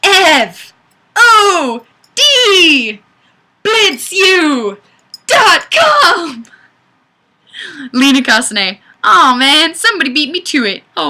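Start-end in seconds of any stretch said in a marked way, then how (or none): 0.0-6.4 loud, high, shouting each letter out; excited shout. Real, not acted. Streamer hyping a returning viewer's web address with a loud, high shout. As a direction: announce it like a hype man. Loudly calling out the fan site.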